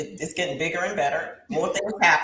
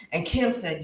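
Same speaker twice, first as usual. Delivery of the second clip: ordinary talk, nothing marked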